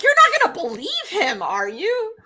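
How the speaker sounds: exasperated disbelief. Retold or imitated. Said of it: "Apollo's like".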